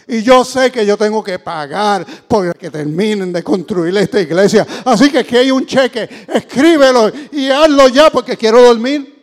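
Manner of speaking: loud, pressing, sleepless plea; a hammed-up desperate businessman; desperate merchant. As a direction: push the cheque on him, at wit's end.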